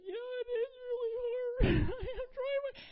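high-pitched, hammed-up wailing cry; mock sobbing. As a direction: bawl it out in an exaggerated squeal. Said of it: "like, just sobbing".

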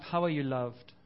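ordinary talk, nothing marked

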